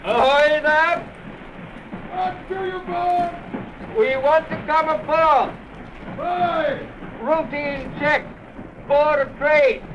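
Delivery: long-drawn call across the water; spaced-out seaman's hail; hailing a ship. Acted. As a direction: call out to a distant deck, stretching each phrase.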